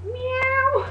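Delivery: high, loud meowing cat. Acted. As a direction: meow like a cat. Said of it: "I can't do that".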